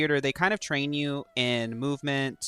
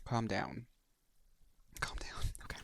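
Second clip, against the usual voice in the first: quiet; 6 semitones lower, 15 dB quieter than usual. Pretend. Telling herself to calm down.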